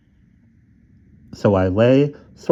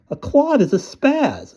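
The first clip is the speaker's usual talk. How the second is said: high, slow spelled-out answer; exasperated explaining; slower than usual. Acted. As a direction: spell it out for her slowly. Kid explaining slang to mom.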